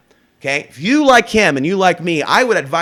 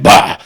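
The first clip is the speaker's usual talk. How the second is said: dismissive loud bah; 10 dB louder than usual. Pretend. After the bully quote.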